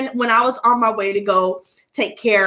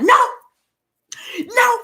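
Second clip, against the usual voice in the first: defiant butterfly; 12 semitones higher than usual. Retold or imitated. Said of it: "Dilbert was like".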